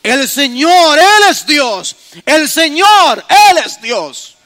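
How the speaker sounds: loud slow shouted acclamation; crowd proclaiming. Retold or imitated. Preacher voicing the people shouting after the fire. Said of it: "se postraron sobre su rostro y dijeron".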